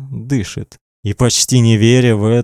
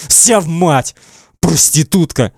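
raised, accusing narrated cries; shouting; 6 semitones higher than usual. A narrator's reading. Man likens girl to her mother, shouting insults. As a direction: fling the accusation out higher. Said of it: "кричит Эдди".

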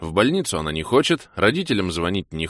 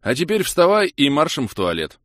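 loud, higher commanding shout; barked order; 8 semitones higher, 6 dB louder than usual. A narrator's reading. He orders hungover Kira to the toilet, loud command. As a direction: order her up like a drill sergeant. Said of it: "отдал следующий приказ".

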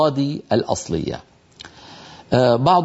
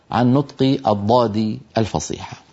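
usual speaking voice

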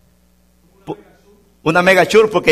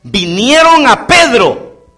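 slow, louder preaching cadence; fervent preaching; 4 semitones higher, 3 dB louder, slower than usual. Acted. Pastor reads the Bible story to the church in preaching cadence. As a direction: preach it from the pulpit.

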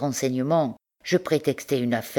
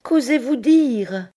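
high, loud, slow shocked question; shocked outrage; 11 semitones higher, 5 dB louder, slower than usual. Acted. Bertrande, stunned by Pierre's accusation, cries out high and slow. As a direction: recoil in disbelief. Wife hears husband called impostor.

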